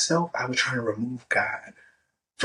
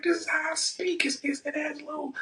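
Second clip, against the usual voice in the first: higher-pitched mock Gary Vee, brash and loose; hamming up a blunt mentor's pep talk; imitating Gary Vee; 12 semitones higher than usual. Podcaster quoting Gary Vee's advice in a higher mock voice. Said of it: "And Gary was like".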